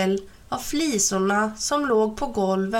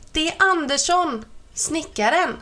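raised high call into a deaf ear; calling into his ear; 7 semitones higher than usual. A narrator's reading. Gnome mother calls into deaf grandpa's ear, raised voice. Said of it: "ropade tomtemor i hans öra".

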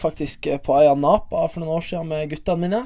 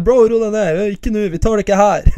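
raised, louder mock peacemaker; lightly acted urgent friend talking him down; pal holding someone back; 4 semitones higher, 4 dB louder than usual. Imitating friends defusing a street fight.

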